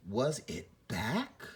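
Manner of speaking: "Was it back?" slow, rising on back; suspenseful slow question. Shown as a demonstration. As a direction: draw it out and lift back. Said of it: "question mark really lets us know how our voice should be moving".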